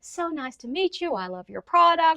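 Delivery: imitating a friendly client. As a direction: play the overly nice guy on a call. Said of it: "he was just the nicest guy".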